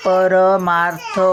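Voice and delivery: परमार्थ said slowly with a light half र before थ; slow demonstration. Shown as a demonstration. Teacher showing pupils the half र, slowly. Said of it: "आता बघा".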